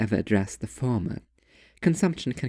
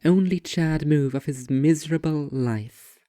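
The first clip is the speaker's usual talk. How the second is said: plain reading voice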